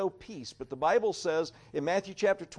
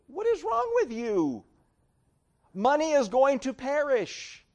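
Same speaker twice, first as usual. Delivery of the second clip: much higher, slow and disbelieving; incredulous inner thought; 8 semitones higher, slower than usual. Retold or imitated. Preacher voicing his baffled thought at money-minded voters, incredulous. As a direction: ask it like you can't believe it. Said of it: "I'm scratching my head and I'm thinking".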